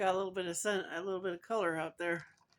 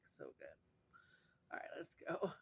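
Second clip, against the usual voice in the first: soft hushed whisper; 9 dB quieter than usual. Real, not acted. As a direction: whisper it like a secret. Settling in to eat, hushed.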